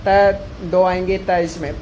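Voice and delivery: ordinary delivery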